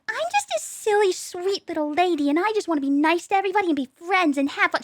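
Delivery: put-on high, sugary little-girl voice; mock a fake-cute sweetheart; mock sweet lady. Mocking fake-nice women in a high sugary voice. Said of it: "I fucking hate when people are like".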